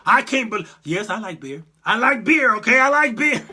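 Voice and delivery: loud, indignant mock testimony; mock angry Kavanaugh. Mocking Kavanaugh's hearing for the stream, loud indignant outburst. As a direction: bark it out like an offended judge under questioning. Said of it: "He was pissed off".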